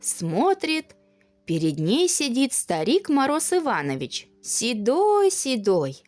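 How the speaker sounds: ordinary delivery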